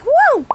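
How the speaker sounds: high, loud yelp; sudden involuntary outburst; vocal tic whoa. Real, not acted. Tic breaking into her story, high yelp. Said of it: "it's starting to happen again".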